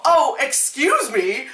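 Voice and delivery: slow, high, exaggerated polite query; mock an absurdly polite response; mock politeness. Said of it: "would you go".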